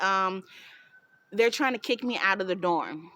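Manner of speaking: normal talking voice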